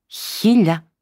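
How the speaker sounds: shocked cry, high and loud; gasped out. A narrator's reading. Wife stunned at the dowry sum, high and loud. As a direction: gasp the number in disbelief. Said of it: "αχνίζοντας πάλι".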